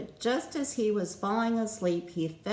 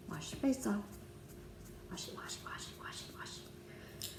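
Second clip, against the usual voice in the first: whisper; 13 dB quieter than usual. Pretend. Bedtime routine, whispering wash.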